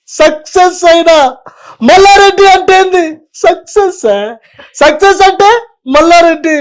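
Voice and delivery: loud, high, emphatic politician's speech; mimicking Malla Reddy's famous boast, loud and high; Malla Reddy impression. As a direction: boom it out like a proud minister on stage. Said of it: "Mallareddy గారి voice చెప్తాను".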